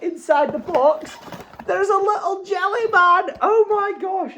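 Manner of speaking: high, slow, delighted reveal; awed by a tiny gift. Real, not acted. Finds little jelly man inside.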